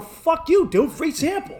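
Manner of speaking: loud, high-pitched retold protest; indignant refusal. Tourist refuses to pay the dealer, loud indignant protest. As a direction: act out an outraged customer refusing.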